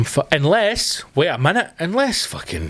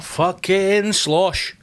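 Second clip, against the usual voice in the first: mock slow gorilla voice; 3 semitones higher, much slower than usual. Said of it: "he's like".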